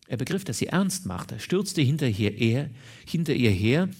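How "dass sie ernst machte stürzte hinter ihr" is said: ordinary delivery, nothing marked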